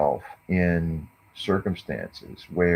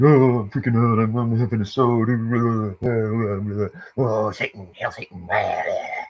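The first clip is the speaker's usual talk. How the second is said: higher, louder mock freak-out voice, fading by the end; mocking girl in the car; 4 semitones higher than usual. Mocking the girl in the car's cheesy tantrum, put-on high voice. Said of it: "it's really cheesy. And it's loud".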